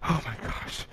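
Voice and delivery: whispered under his breath; tense hushed gasp of words; nervous whisper. Real, not acted. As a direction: breathe out the nerves. Tense at-bat, nerves.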